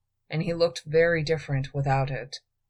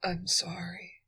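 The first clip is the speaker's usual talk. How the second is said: faint breathy whisper; whispered apology; 3 semitones higher, 8 dB quieter than usual. A narrator's reading. Weak thief whispers sorry to his queen. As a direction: barely voice the apology.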